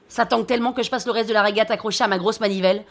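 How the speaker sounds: usual speaking voice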